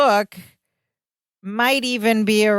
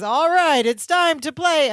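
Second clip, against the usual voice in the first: game show host; 7 semitones higher than usual. Retold or imitated.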